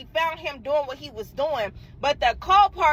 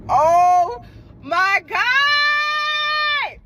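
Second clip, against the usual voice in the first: very high, loud, hugely stretched oh my God; long high mortified wail; horrified wail; 8 semitones higher, 6 dB louder, much slower than usual. Retold or imitated. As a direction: drag it out in a crying shriek. Reacts to being dumped.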